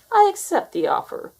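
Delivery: sly grin in the voice; sly announcement. A narrator's reading. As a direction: announce it like you've already won. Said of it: "he announced with a sly grin".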